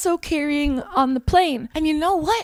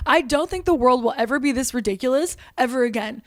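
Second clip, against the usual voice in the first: normal talking voice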